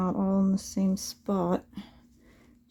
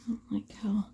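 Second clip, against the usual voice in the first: whispering; 6 dB quieter than usual. Real, not acted. Muttering at work.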